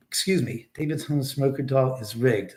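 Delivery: normal talking voice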